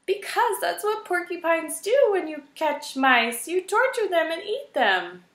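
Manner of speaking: high, small mouse voice, scared; a timid mouse answering the porcupine; frightened little mouse. A narrator's reading.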